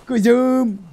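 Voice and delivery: put-on high, loud, stretched-out baby talk; doting coo. Player finding the horse is female, loud cooing. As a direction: gush over a beloved pet.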